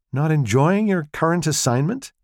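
a light mischievous question; impish. Acted. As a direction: needle the intern gently. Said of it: "in an impish tone".

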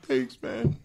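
high, hushed mock gratitude; imitated meek thanks. Underpaid guys thanking the boss, meekly imitated. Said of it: "they're like".

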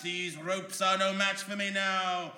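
normal talking voice

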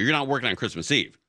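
usual speaking voice

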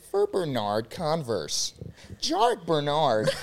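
slow, lightly voiced sign-off; impression of a friend. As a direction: sign off slowly like a reporter closing a story. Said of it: "my impression of you".

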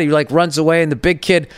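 ordinary talk

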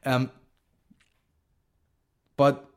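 ordinary delivery

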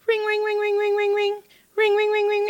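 very high, monotone rapid ringing; imitated phone ringing. Therapist imitates the office phone ringing nonstop, high imitated ringing. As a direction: mimic an insistent phone in a high voice.

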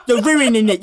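high, laughing protest; cracking up while scolding. Real, not acted. Laughing at the bit.

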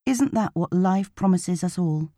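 ordinary delivery

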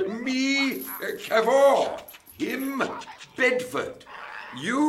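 very high, separated words; simplified introductions. Acted. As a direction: teach names to an alien, word by word. Introducing himself to an alien.

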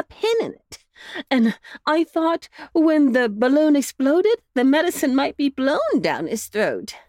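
a fussing mother explaining her sick pup; mother dog voice, a touch anxious; worried mother dog. A narrator's reading. Missus Bow Wow explains plan.

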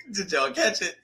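laughing as you ask the room again; amused check that they followed. Real, not acted. Laughing repeat of question.

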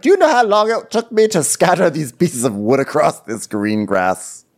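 hammed-up huffy designer voice; mock upset designer. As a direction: gripe like an offended set designer. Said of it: "made some production designer very upset".

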